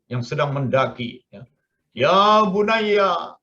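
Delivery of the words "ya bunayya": long, high, loud drawn-out call. Acted. Noah summons his son climbing the mountain, calling out. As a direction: call out to a distant son, stretching each word. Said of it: "Maka Nabi Nuh mengambil, memanggil".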